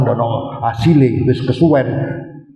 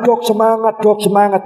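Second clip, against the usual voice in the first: nurses cheering on; 7 semitones higher than usual. Retold or imitated.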